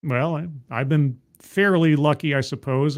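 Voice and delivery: ordinary talk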